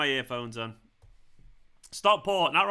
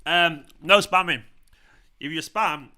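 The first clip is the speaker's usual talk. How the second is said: stern warning; 2 semitones higher, 9 dB louder, slower than usual. Real, not acted.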